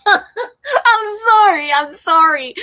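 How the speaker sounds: loud, high, helpless laughter; laughing apology. Real, not acted. Host apologising to viewers for their joke, laughing hard. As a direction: apologise while cracking up.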